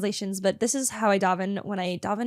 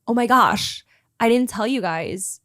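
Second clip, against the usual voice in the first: ordinary talk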